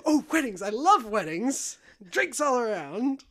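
gushing, high and loud delight; slow, theatrical rapture, drawing out the words; hammed-up excited toast; Jack Sparrow. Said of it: "Jack goes".

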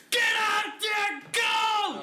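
high, slow full-voiced fan yell; cheering shout. Friend imagining dad cheering for the Texans, shouting. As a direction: roar the team on like a sports dad. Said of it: "imagine him fucking cheering for the Texans".

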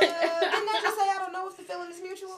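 high, laughing mock reply; laughing quote. Annie brushing off the man, high laughing quote. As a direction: laugh through her comeback. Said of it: "And she said".

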